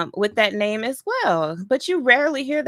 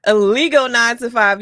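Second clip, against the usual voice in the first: louder, leaning on illegal; stress on illegal; 7 dB louder than usual. Shown as a demonstration. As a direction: punch the word illegal. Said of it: "You see how she put emphasis on that".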